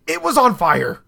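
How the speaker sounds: high, loud, incredulous yell; retold exasperated shout. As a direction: shout it like they still don't get it. Exasperated retort to manager, retold.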